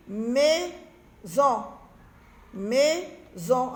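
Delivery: "maison" split in two with a clear gap; syllable by syllable. Shown as a demonstration. Teacher checking sounds with children, "maison" slowly split. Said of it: "dans maison, j'entends ni O".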